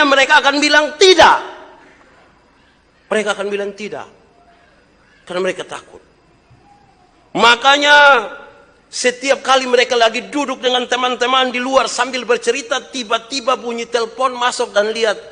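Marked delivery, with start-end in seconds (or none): 0.0-1.4 high, loud shouted line; shouting from the pulpit; preaching shout. Acted. Preacher repeats line for effect. 7.4-15.2 high, loud preacher's cry; fiery sermon voice; full-force preaching. Acted.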